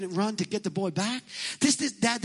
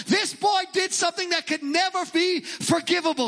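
outraged Pharisees; 8 semitones higher than usual. Retold or imitated. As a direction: play the Pharisees in a religious conniption. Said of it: "They're like".

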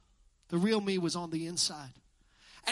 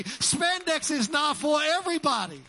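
emphatic proclamation; 8 semitones higher, 5 dB louder than usual. Real, not acted. Pastor asking the congregation to clap in agreement, loud emphatic proclamation. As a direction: proclaim it to rally the crowd. Repeats it louder for applause.

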